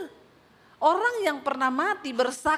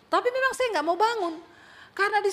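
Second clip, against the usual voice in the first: excited recollection; 9 semitones higher, 4 dB louder than usual. Retold or imitated. Retelling her blissful dream.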